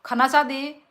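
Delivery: loud high exasperated scold. Real, not acted. Mother scolding her full child to stop eating.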